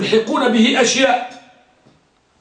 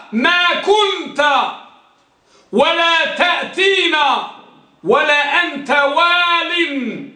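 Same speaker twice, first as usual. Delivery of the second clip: higher, slow and insistent; emphatic paraphrase; 5 semitones higher, slower than usual. Shown as a demonstration. Teacher spelling out the negated meaning, slow and high. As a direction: hammer home the meaning. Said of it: "كأنك قلت".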